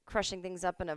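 usual speaking voice

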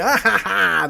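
high mock group cackle; imitated laughter. Women laughing at their driver's banter, mock laughter. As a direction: imitate delighted passengers cracking up. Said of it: "they laugh".

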